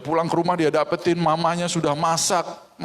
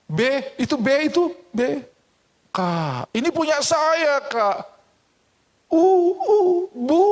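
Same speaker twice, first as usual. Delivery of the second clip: put-on high, teasing child taunts; whiny child pleading then taunt; child voices; 12 semitones higher than usual. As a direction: tease like a bratty kid. Siblings' taunting exchange.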